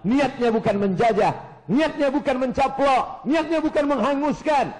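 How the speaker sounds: high, emphatic repeated declarations; impassioned preaching. Real, not acted. Preacher defends the conquest to congregation, rousing emphatic delivery.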